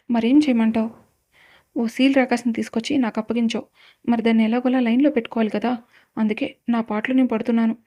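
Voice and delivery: usual speaking voice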